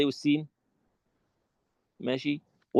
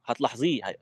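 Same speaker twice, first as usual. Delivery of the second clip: usual speaking voice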